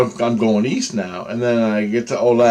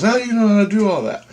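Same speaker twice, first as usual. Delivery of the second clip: put-on girl's voice; 11 semitones higher, faster than usual. Girl voiced in retold scene.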